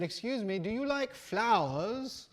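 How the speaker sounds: high, loud mock British accent, drawn out. British rambler asking walkers about flowers, hammed-up British accent. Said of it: "put on a British accent when I can".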